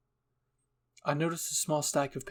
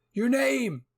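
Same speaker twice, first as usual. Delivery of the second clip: higher, louder call across the room; 10 semitones higher, 7 dB louder than usual. A narrator's reading. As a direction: shout after her to stop. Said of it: "Eren called after me".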